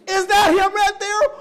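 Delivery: loud, high, drawn-out disbelief; astonished roommate. Retold or imitated. Roommate pointing out his friend's dad on TV, astonished.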